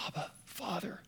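soft, intimate cry to a father; hushed retold cry. Preacher quotes Jesus praying by the rock, hushed cry. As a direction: let the cry drop almost to a whisper. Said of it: "I heard him cry out these words".

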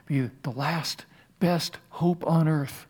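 slow, softened, word-by-word quote; deliberate quoting. Retold or imitated. Pastor quoting Lincoln on America, slow and deliberate. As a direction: let each word of the quote stand alone.

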